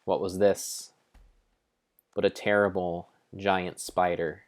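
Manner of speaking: ordinary delivery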